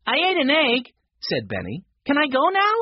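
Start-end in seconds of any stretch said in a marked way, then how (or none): 0.1-0.9 put-on high, louder child's voice; impatient young boy's high voice; eager little boy. A narrator's reading. Benny eager to be let go. 2.1-2.7 put-on high, louder child's voice; impatient young boy's high voice; eager little boy. A narrator's reading. Benny eager to be let go.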